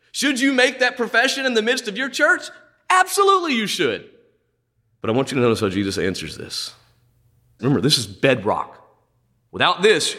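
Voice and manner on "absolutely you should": ABSOLUTELY flung out high and falling; Absolutely ringing up high, then dropping hard; emphatic absolutely. Acted. Preacher to congregation, booming high ABSOLUTELY. Preacher answers his own question.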